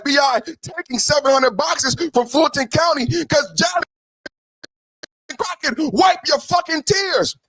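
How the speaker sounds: heated rant. Real, not acted. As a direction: rant and yell at her to stop crying. Ranting at a politician.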